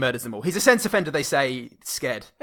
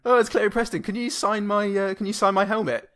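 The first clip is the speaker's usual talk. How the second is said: higher, excited gushing; starstruck fan; 7 semitones higher than usual. Retold or imitated. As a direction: gush like a fan asking an autograph. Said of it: "starstruck fan".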